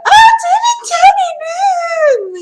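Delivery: shrieking high like a starstruck fangirl; fangirl scream. Retold or imitated. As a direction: scream his name at the screen. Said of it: "screaming that".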